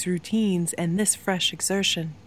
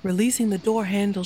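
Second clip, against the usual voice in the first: ordinary delivery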